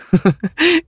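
short high giggle. Acted. Bunch giggling to her husband, high. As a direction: giggle nervously.

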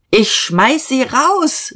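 high, slow, drawn-out threat; indignant call. A narrator's reading. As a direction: cry it out in outrage. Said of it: "rief Diederich".

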